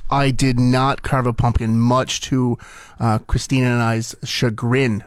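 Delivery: ordinary talk, nothing marked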